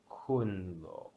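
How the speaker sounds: "kun lo" said very slowly, lo following the fall of kun; neutral tone demo. Shown as a demonstration. Teacher demonstrates the neutral tone after a falling syllable, slowly. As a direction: stretch "kun lo" out, letting "lo" sink after "kun". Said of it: "what the lo would sound like".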